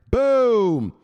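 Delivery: loud, drawn-out falling boom; big celebratory shout; booming exclamation. Pretend. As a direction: punch it out like an explosion. Celebratory catchphrase called out.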